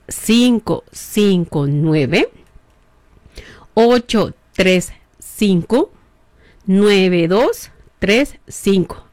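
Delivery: each digit slow and spaced for writing down; slowly. Shown as a demonstration. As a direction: dictate the number very slowly with long pauses. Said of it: "¿o qué lo voy a decir despacito?". (dictando el número muy despacio)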